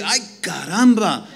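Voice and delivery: laughing Spanish "Ay, caramba"; Mexican exclamation. Retold or imitated. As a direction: toss out "Ay, caramba" with a chuckle. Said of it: "if you're Mexican like me".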